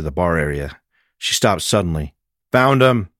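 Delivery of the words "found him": higher, louder call from the next room. Acted. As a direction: let the others know you found him. Detective calls from the bar.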